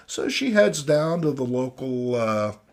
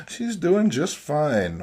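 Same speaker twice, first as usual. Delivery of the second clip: usual speaking voice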